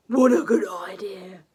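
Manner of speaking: loud, lightly voiced eager dragon; Zog the dragon. Zog eagerly accepting the girl's offer, loud. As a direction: answer like a keen young dragon. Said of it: "said Zog".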